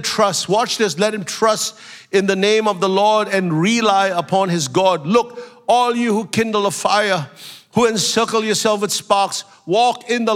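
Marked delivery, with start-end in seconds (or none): none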